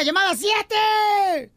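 slow, high announcer yell; shouted call. Shown as a demonstration. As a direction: stretch the call out big. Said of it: "pero ¿por qué no grita?".

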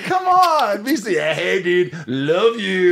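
giggling mock pep talk; laughing bro hype. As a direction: hype yourself up like a cheesy bro. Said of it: "Finger guns".

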